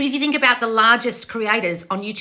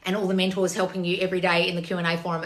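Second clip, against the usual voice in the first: normal talking voice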